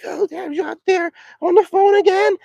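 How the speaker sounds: high imitation of a son scolding his dad; imitating son. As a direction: play the son calling him out, high. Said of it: "he doesn't talk like that".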